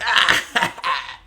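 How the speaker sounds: high, loud goblin cackle; mocking goblin laugh. Goblins laughing at a brawl, high mocking laugh. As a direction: burst out in a jeering cackle. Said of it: "begin laughing at them".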